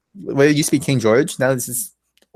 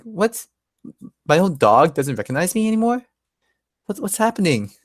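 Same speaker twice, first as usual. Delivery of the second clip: higher-pitched, mildly acted bewilderment; baffled dismay; 6 semitones higher than usual. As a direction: voice Rip's puzzled hurt, pitched up. Rip's dog runs from him.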